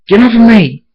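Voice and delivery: high, loud struggling yell; panicked shout. A narrator's reading. Blaze tackled by timberwolves, shouting them off. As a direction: shout to fight off an attacker.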